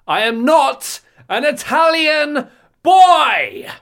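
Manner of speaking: high, loud and slow, a desperate British yell; British shout. Pretend. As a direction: shout it out as a stressed-out British lad. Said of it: "in your very British accent".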